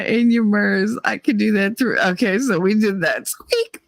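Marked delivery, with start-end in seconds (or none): 3.2-3.8 high, soft squeaky-toy squeak; squeak imitation. Shown as a demonstration. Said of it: "you could hear squeak".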